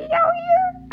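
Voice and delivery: high, stretched country twang; put-on country accent. Shown as a demonstration. Showing listeners the accent picked up out here, exaggerated country twang. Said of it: "like, an accent".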